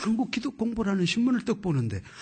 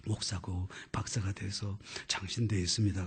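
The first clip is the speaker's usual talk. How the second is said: normal talking voice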